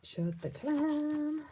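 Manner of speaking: a slow sing-song taunt; drawn-out catchphrase. Pretend. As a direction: draw the words out teasingly.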